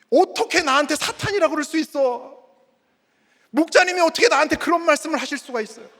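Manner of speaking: high, loud acted protest; hammed-up angry objection; indignant outburst. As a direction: complain as if deeply offended. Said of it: "이러면서 막 화를 내고".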